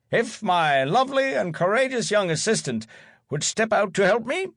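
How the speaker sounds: booming theatrical call; showman announcing. Acted. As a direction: open like a stage magician. Magician announcing to audience.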